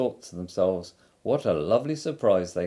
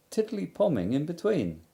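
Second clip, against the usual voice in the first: ordinary delivery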